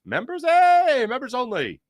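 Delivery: a gleeful lightbulb moment; playful realization. Real, not acted. Host getting the chat joke, playful excitement.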